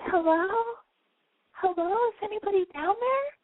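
put-on high little girl calling into a well. A narrator's reading. Narrator voices the little girl calling into the well, high. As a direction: call down the well in a high girl's voice. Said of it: "and she goes".

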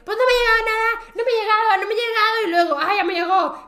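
worked-up dad, pitched high; mock exasperated yell; retold yelling. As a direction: imitate a dad yelling into the phone. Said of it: "y se lo pasa gritando".

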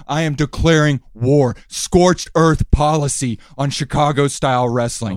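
loud, menacing heel promo; wrestling promo. Acted. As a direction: cut a threatening promo.

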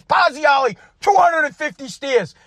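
a coach handing out a punishment. Retold or imitated. Coach punishing the speaker for the fight, a barked order. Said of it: "My coach comes out".

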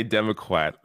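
"Democrat" said as "Demo-kwat"; mimicked lisp on "Democrat", ending "kwat". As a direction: swap the r in "Democrat" for a w. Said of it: "a funny lisp saying Democrat".

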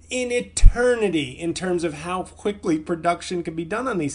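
high, loud, stretched-out fury; venting real rage at the delay. Real, not acted. Ranting to listeners about his stalled special, enraged. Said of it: "that rage is just beneath the surface".

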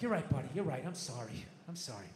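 low, soft apology fading away; contrite. Acted. Dad apologizing to his small daughter, contrite. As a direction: sink into a hushed, sheepish sorry.

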